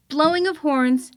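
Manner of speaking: ordinary delivery, nothing marked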